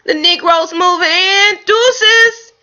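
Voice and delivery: high sassy squirrel trash talk; mock squirrel comeback. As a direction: square up cheekily. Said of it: "And I say".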